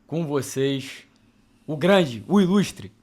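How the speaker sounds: rising announcer's build-up, loud; grand entrance. Acted. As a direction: announce the star guest with fanfare. Host announcing the guest's entrance.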